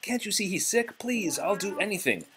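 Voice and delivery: high, soft begging; pleading. Acted. Belle begging the beast to free her father. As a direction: beg him for mercy. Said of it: "Belle begged".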